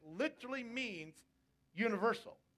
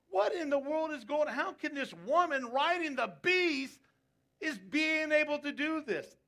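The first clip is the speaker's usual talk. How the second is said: shocked disbelief; 6 semitones higher, 5 dB louder than usual. Retold or imitated. Voicing John's shock.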